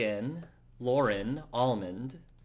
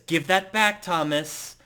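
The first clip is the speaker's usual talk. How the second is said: higher, louder annoyed demand; raised-voice annoyance; 6 semitones higher, 6 dB louder than usual. Acted. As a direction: demand it back, exasperated. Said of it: "[name] looks extremely annoyed".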